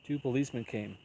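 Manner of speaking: usual speaking voice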